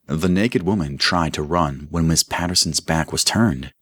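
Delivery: plain reading voice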